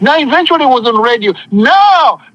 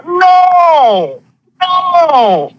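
emphatic no; 3 semitones higher than usual. Acted. Preacher rejecting poverty belief.

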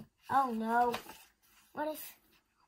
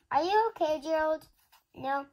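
usual speaking voice

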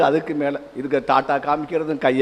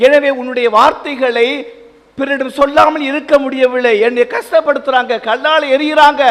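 high, loud pleading lament; the prophet Jeremiah complaining to God, loud and pleading; prophet's lament; 8 semitones higher, 4 dB louder than usual. Acted. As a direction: cry out to God like a wounded prophet. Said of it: "Jeremiah ... ஆண்டவனிடம் புலம்புவதை".

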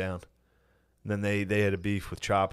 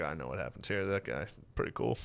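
smug quiet; 4 semitones lower, 7 dB quieter than usual. Retold or imitated. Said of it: "I'm like".

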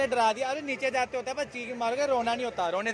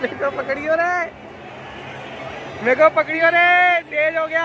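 panicked call to be held; scared cry; 8 semitones higher, 6 dB louder than usual. Real, not acted. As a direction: cry out in real fright on the ride. Scared on fast ride, calling.

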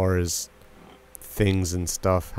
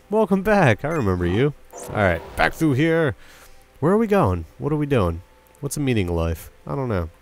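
normal talking voice

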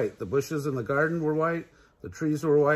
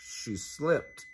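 usual speaking voice

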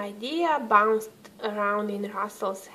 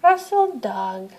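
ordinary delivery

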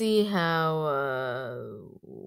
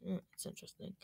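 ordinary talk

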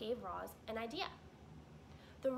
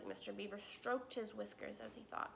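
usual speaking voice

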